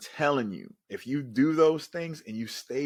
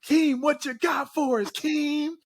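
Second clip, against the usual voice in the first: high, louder hyped call; teammates calling out; 5 dB louder than usual. Retold or imitated. Teammates before the game calling on Keem to speak, high excited call. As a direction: call him up like an eager teammate.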